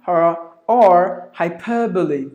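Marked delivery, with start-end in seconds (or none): 1.3-2.3 "hyperbole" as hai-PER-buh-lee; four-syllable "hyperbole", stress on PER; schwa kept. Shown as a demonstration. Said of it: "both are correct".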